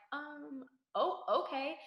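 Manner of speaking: high, hesitant acknowledgement; small, high, halting mock reply; retold stunned reply. Acts out stunned reply to vet.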